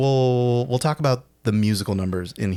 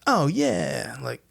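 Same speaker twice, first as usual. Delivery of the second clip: retold quote; 9 semitones higher than usual. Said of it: "Wanda says".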